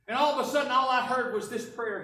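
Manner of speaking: ordinary talk, nothing marked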